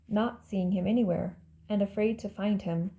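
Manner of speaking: ordinary delivery, nothing marked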